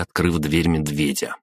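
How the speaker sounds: plain reading voice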